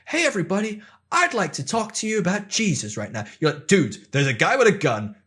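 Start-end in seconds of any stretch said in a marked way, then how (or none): 0.0-3.2 much higher, sunny church greeter; chirpy invitation to talk about faith; mock cheerful preacher pitching to a panicked room; cheery evangelist. Evangelist interrupting a gun scene. 3.7-5.2 louder and higher, frantic with a laugh; mock yell at someone wasting your time; half-laughing frantic brush-off; panicked outburst. Panicked reply during a shooting.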